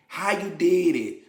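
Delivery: ordinary talk, nothing marked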